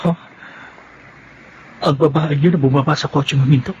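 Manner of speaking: catching your wife with another man; shocked discovery. Acted. Husband sees wife kissed by man. (gulat na gulat, palakas nang palakas)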